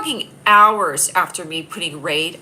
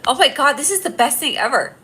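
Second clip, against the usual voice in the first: excited; 8 semitones higher, 5 dB louder, slower than usual. Real, not acted. Gleeful about reporting comments.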